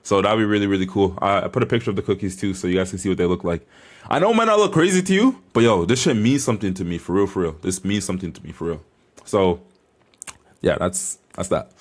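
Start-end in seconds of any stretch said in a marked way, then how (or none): none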